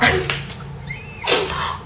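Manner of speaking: a shrieking giggle; high squeal of laughter. Real, not acted. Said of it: "não é assim".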